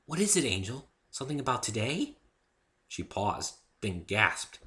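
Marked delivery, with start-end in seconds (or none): 0.0-2.2 gentle Fluttershy voice. Acted. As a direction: speak sweetly and timidly.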